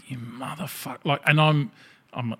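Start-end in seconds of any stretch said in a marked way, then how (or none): 0.1-1.0 soft, seething curse under the breath; a soft curse muttered under the breath. Retold or imitated. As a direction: swear quietly to yourself. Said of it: "And I'm like".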